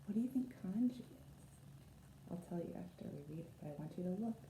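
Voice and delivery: soft whispered-down storytime question; quiet aside to children. Acted. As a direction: draw the kids in quietly. Asks the listening children.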